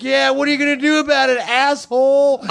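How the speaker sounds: high-pitched cocky comeback; mock defiant taunt. Storyteller as fifteen-year-old taunting a man, high mock defiance. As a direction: taunt him high, stretching the last word.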